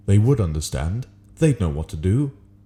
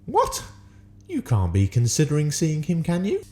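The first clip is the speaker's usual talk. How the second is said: Flitwick voice; 10 semitones higher than usual. A narrator's reading. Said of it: "asked Professor Flitwick".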